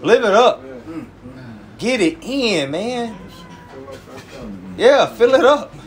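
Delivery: high, punchy mock hype; teasing party talk; mock encouragement. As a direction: cheer them on sarcastically, pitched high. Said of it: "I was talking like this".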